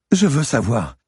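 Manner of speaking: put-on high, desperate old lady; insistent old woman. A narrator's reading. Old lady demanding the truth about her husband, desperate.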